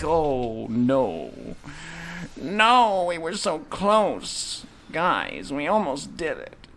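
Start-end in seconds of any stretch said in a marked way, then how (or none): 0.0-4.6 high, loud groan of disappointment, slow on the last words; dismayed cry. Real, not acted. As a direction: wail that you almost had it. Just failed the boss attempt.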